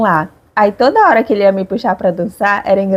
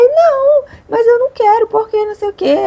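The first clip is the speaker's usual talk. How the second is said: high, pleading protest; retelling her flustered no to Danilo; upset refusal; 13 semitones higher than usual. Retold or imitated. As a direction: beg him to understand, voice climbing. Quoting her own upset refusal.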